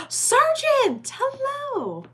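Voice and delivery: gasp, then a high, loud greeting; delighted gasp. Real, not acted. Streamer spotting a regular in chat, gasping in delight. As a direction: gasp and call the name in delight.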